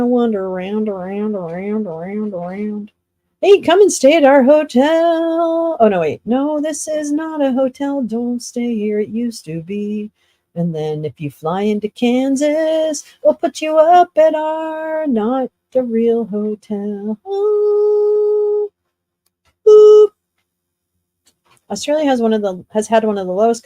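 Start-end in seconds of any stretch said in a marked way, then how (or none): 3.4-5.8 cheesy ad pitch. Pretend. Mock hotel ad pitch. 17.3-20.1 high mock ooh. Mock cooing reaction.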